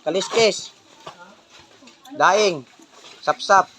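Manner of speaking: loud street vendor cry; calling out wares. Real, not acted. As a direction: shout the goods to neighbours. Vendor calling out dried fish.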